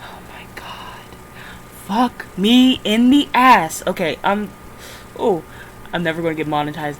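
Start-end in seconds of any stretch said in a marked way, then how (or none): 0.0-1.2 slow unvoiced whisper; slow hopeless whisper; despairing whisper. Real, not acted. Despair at forgotten password. 1.9-3.8 loud, slow drawn-out curse; loud slow exasperated groan of words; exasperated outburst. Real, not acted.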